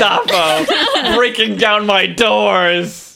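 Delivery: laughing through a slow mock-menacing line; hammed-up doom, breaking into laughter; laughing mock dramatic. Joke voice about watermelon Gestapo.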